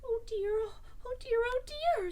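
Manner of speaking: high-pitched little worried voice; Piglet fretting. A narrator's reading. Piglet frets to himself nearing the trap, high and worried. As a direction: fret in a small high voice, slowing. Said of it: "said Piglet to himself".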